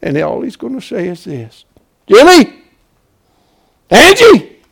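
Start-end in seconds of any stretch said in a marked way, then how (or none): 2.1-4.6 high, loud calls, name by name; shouted summons. Acted. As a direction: call each one up from the grave. Calls names as at the resurrection.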